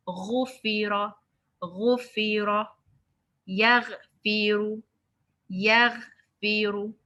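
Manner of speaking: slow throaty recitation drill; slow full mouth drill. Shown as a demonstration. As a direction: drill yaghfiru slowly from the throat. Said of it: "ra is from the throat".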